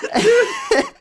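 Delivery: sudden very high laugh; high squealing laugh. Real, not acted. Host remembering the Elon meme, squealing laugh. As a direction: crack up.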